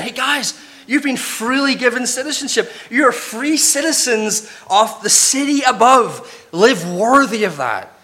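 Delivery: high, eager appeal in Paul's voice; rally them like an excited apostle; Paul urging the church. Retold or imitated. Preacher voicing Paul to the believers, eager and high. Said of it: "this is really what Paul's saying".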